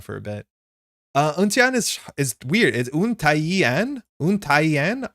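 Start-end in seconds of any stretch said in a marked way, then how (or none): 2.9-4.0 Untaiyan slowly, as oon-tai-yee-en; extra syllable. Shown as a demonstration. Streamer working out a name, slow syllable-by-syllable Untaiyan. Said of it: "it has the extra syllable there". 4.2-5.1 Untaiyan, higher, as oon-tai-yen; extra syllable. Shown as a demonstration. Streamer repeating a hard name, syllable-by-syllable Untaiyan. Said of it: "it has the extra syllable there".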